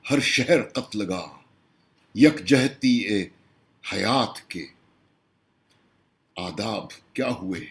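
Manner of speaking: projected mushaira-style recital; poem recitation. Acted. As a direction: put each question to the audience in verse.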